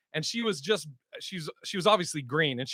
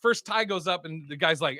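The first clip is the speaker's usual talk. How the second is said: ordinary talk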